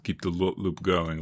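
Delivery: normal talking voice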